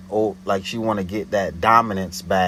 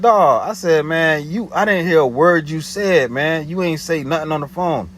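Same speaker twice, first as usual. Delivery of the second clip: higher, louder exasperated complaint; retelling his heated complaint on the phone; raised voice; 7 semitones higher, 4 dB louder than usual. Retold or imitated. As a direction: call her out, louder.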